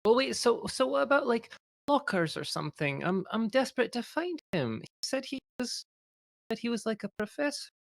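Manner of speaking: high, soft pleading voice; small, high voice of someone desperate; desperate, near tears. Acted. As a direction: plead like a girl about to cry. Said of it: "You're gonna make the poor girl cry".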